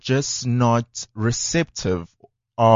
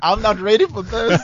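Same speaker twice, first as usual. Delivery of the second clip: mock panic; 9 semitones higher than usual.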